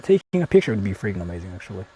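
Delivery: ordinary talk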